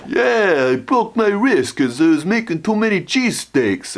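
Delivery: high-pitched Italian restaurant owner; Italian guy impression. Italian restaurant owner explaining his broken wrist, high-pitched. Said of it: "he was an Italian guy".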